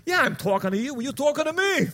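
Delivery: mock New York tough-guy reply, rising in pitch; God as De Niro. God in heaven answering the actor, De Niro impression. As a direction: answer back in a put-on De Niro voice. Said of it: "And God's in heaven. He's like".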